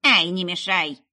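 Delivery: loud dismissive crone; witch brushing off. A narrator's reading. Witch brushing off Meryl, loud and dismissive. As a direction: wave her off with a snap. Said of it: "отмахнулась ведьма".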